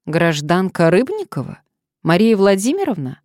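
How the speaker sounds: loud, formal name check; verify the name like a displeased official; dry official voice. A narrator's reading. Official stranger addressing a citizen by phone, dryly. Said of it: "Сухой голос".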